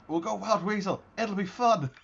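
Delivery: high, loud, gung-ho pilot impression; mock eagerness. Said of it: "Don't know if they were like".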